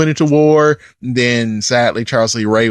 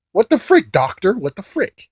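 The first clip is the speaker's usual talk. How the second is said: exasperated disbelief; 6 semitones higher than usual. Pretend. Reviewer mock-scolding the movie doctor, high incredulous voice. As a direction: squeak out your disbelief at the doctor.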